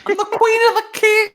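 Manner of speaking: put-on high whiny ghost girl voice.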